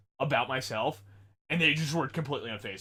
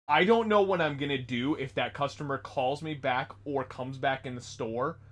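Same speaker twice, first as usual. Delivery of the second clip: ordinary talk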